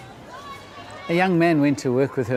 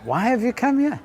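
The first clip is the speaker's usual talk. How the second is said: put-on high, louder gentle questioning old lady; Mother Teresa's question; 10 semitones higher, 4 dB louder than usual. Storyteller voicing Mother Teresa questioning the young man, high and gentle. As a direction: voice a kindly old nun asking why he came.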